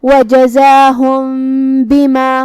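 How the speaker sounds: hidden meem with nasal hum before بِمَا, slow; ikhfa demo. Shown as a demonstration. Teacher showing ikhfa, slow with nasal hum. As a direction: hold a nasal ghunna on the meem of وَجَزَاهُمْ into بِمَا. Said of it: "یہ حکم کو ادا کرنا ہے".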